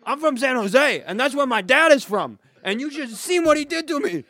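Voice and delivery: high, loud aggrieved bro. Acted. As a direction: bark it like a wounded tough guy. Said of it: "my line".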